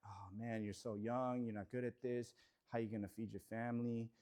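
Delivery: low, soft, defeated self-talk; doubting inner voice. Retold or imitated. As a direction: mutter the doubts to yourself, beaten down. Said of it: "My, my inner talk was always like".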